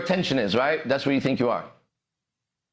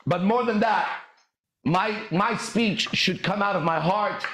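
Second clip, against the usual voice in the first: usual speaking voice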